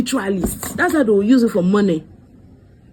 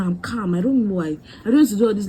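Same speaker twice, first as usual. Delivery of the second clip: usual speaking voice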